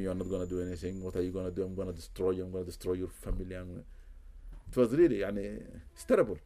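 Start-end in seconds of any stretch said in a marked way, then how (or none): 0.0-3.8 lightly voiced jinn, threatening, a touch lower; understated menace, keep it close to your own voice; a hint of a man's voice. Jinn threatening the exorcist, lightly voiced low menace. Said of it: "with the voice of a man".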